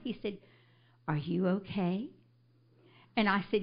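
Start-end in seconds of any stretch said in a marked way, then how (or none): 1.1-2.1 soft, tender question; sweet, gentle. Retold or imitated. Stranger bending over the fallen woman, sweet and gentle. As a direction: imitate a kind stranger checking gently. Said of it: "sweet, gentle sound".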